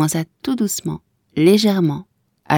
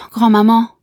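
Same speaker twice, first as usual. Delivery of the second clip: a high, louder startled cry of grand-maman; surprised and moved; 6 semitones higher, 5 dB louder than usual. A narrator's reading. Kezia to her grandmother, surprised and moved. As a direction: call her name in sudden tender alarm. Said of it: "d'une voix surprise et émue".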